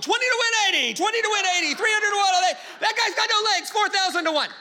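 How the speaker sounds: bookie calling odds. Retold or imitated. Heavenly bookie shouting betting odds to the crowd, loud. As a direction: bellow the odds over a crowd. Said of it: "some bookie in heaven going".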